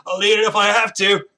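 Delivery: loud, high squirrel voice; Diedrich. Acted. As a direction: butt in loudly as a belligerent squirrel. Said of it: "then you hear Diedrich go".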